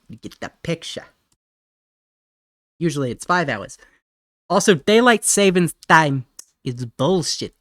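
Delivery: poor attempt at a Boston accent; Boston or New York accent. Streamer explaining time zones to chat, in a mock Boston mobster accent. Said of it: "I don't know where this accent came from".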